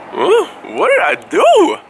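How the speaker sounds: high squeaky huh; squeaky what did I do; mock innocent surprise. Real, not acted. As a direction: play innocent, voice jumping way up.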